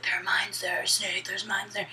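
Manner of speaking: hushed secret informant warning; soft, whispered mock warning. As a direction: whisper it like a mysterious tipster. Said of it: "this guy named Deep Throat".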